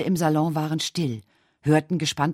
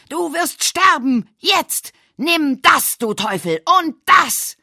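high, loud frenzied yells; murderous scream; 12 semitones higher, 8 dB louder, slower than usual. Acted. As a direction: scream each line as you fire. Edgar firing at his father.

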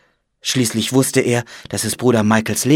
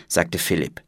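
usual speaking voice

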